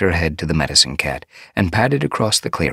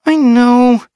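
bouncy apprentice; 11 dB louder than usual. A narrator's reading.

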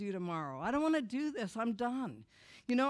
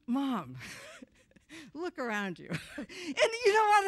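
laughing through the words, higher and louder; laughing disbelief; 6 semitones higher, 5 dB louder than usual. Real, not acted. Son telling the crowd about his mother, laughing in disbelief. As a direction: crack up while you talk to her.

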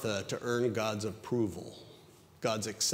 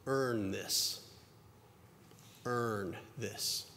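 dying mutter; 8 dB quieter, much slower than usual. Retold or imitated. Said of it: "mutters something as he's dying".

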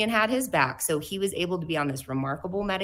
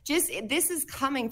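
teary; 5 semitones higher, 6 dB louder than usual. Real, not acted. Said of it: "getting a little teary at it".

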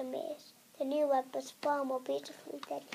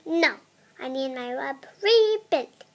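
louder, a mock angry shout; angry yell; 11 dB louder than usual. Spider refusing the princess with a hammed-up yell. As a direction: bark the refusal like a cross spider. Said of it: "yelled the angry spider".

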